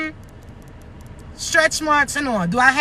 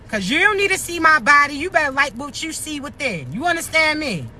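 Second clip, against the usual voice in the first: ordinary talk, nothing marked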